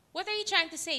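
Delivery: normal talking voice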